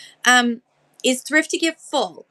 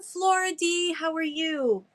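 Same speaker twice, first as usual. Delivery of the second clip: normal talking voice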